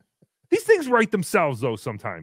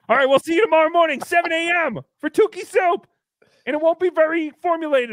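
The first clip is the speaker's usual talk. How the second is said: excited sign-off; 9 semitones higher, 5 dB louder than usual. Real, not acted.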